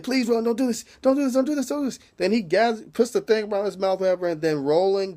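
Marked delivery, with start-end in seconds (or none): 0.0-2.0 high, repeated begging; mock pleading. Prisoner begging Roland not to hurt him, mock pleading. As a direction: beg in a mock-panicked higher voice. Man begging before being beaten.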